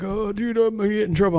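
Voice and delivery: high, lightly panicked friend; mock panicked friend. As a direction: imitate a worried buddy fretting. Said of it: "he's freaking out".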